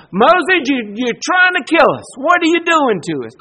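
hammed-up people blaming Moses; mock panicked complaint. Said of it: "Over and over and over".

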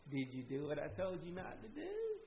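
softly ask, already knowing the answer; hushed, leading question, lifting at the end; quiet parental questioning. Acted. Parent to a child who disobeyed, quiet questioning.

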